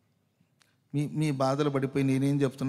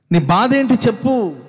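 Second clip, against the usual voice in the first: louder, higher, slow demanding shout; insistent shout; 8 semitones higher, 14 dB louder, slower than usual. Retold or imitated. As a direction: press them to tell their pain. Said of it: "అంటూ ఉంటారు".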